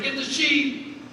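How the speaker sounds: high-pitched mock yell from the cowboys; impatient cowboy hollering at a dad in the chute. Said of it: "cowboys out here yelling at me".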